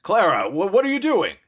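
high, loud startled outburst; shocked parental cry, pitch shooting up; alarmed dad. Acted. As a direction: stammer in alarm at a child. Daddy finds girl at sink.

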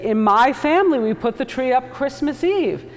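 high, loud indignant wife; a therapist imitating a wife's indignant insistence; wife quoted. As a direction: play the wife insisting on her tradition. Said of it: "And she would say".

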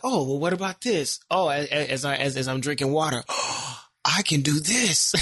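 mock eureka moment, pitch jumping up; excited self-quote. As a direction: act out an idea striking with a gasp. Acting out his sudden new ideas.

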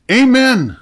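very high, loud shout; desperate cry to stop the horse; panicked shout. A narrator's reading. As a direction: shout it at the top of your lungs. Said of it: "Bill shouted".

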